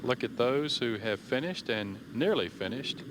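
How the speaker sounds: ordinary talk, nothing marked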